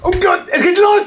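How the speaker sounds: sky-high excited cry, rising at the end; excited squeal. Real, not acted. Fan cries out as his card pack opening starts, squealing.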